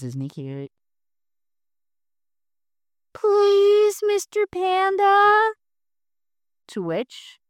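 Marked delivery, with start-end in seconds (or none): 3.1-5.5 much higher, slow little plea; little guy pleading with Mister Panda, tiny and high. Acted. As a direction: beg sweetly like a tiny creature.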